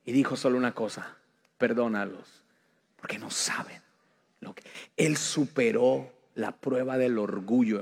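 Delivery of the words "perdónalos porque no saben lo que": susurro débil y apagado; casi un susurro. Acted. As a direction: dilo sin fuerzas, apenas audible. Palabras de Jesús en la cruz. (Jesús en la cruz, susurrando sin fuerzas)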